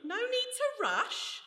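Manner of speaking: high, slow, teasing sing-song; drawn-out tease. Acted. Alice teasing Legs before turning on him, mocking. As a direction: stretch it out to taunt him.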